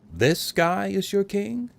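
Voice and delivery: high, slow jeering question; slow mocking taunt; sneering disbelief. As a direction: drag it out with contempt.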